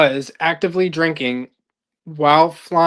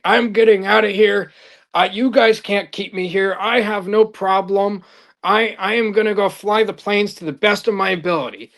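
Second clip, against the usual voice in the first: indignant protest; 5 semitones higher than usual. Retold or imitated. Pilot insisting to rehab staff he can leave, higher and indignant. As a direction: play the outraged pilot refusing rehab, half-acted. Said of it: "He was like".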